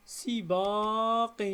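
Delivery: each syllable stretched slowly and apart; drawn out syllable by syllable for pupils; slow syllable split. Shown as a demonstration. Teacher to pupils, splitting the word, slow and drawn out. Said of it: "نجزئها سباق".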